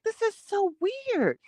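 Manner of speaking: high, slow, drawn-out disbelief; mock horror, drawn out and high; weirded-out quote. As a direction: stretch it out like you can't believe it. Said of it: "I was like".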